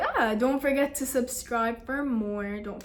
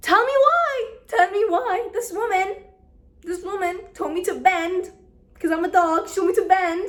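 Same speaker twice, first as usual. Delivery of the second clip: high, loud storyteller's exclamation; indignant outburst; 8 semitones higher, 6 dB louder, faster than usual. Real, not acted. Telling viewers the woman made her bend, high indignant outburst. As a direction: pitch jumps up in exaggerated disbelief.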